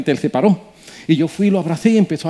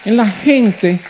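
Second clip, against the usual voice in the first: preaching at full force; 5 semitones higher than usual. Acted. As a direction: preach it to the rafters. Preaching climax.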